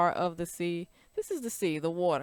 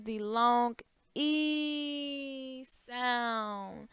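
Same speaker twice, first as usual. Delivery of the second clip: E stretched out very long; 3 dB quieter, much slower than usual. Shown as a demonstration. Teacher showing the long E sound, E held very long. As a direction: draw out E as one long, sustained vowel. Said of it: "talking about, I'm sorry, the long".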